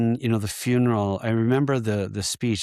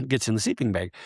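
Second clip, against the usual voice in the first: ordinary delivery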